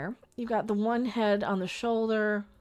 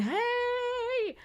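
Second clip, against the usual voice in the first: sudden high call of hey; guy calling hey. Retold or imitated. Decoding a glyph, voicing the guy calling out. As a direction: sing out hey like a figure cheering. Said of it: "this is a guy going".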